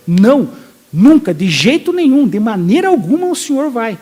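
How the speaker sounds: loud, emphatic refusal, rising in pitch; words of force. Acted. Peter telling Jesus never, in no way, forceful and loud. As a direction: punch "Não" and "nunca" as an absolute no. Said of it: "uma palavra de força".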